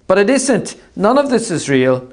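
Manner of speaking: Artemis's voice. Acted. Artemis deluded, calling across.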